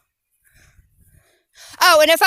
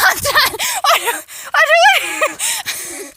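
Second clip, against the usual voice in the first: laughing; 12 semitones higher than usual. Real, not acted. After racing, laughing and gasping.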